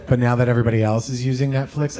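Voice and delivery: normal talking voice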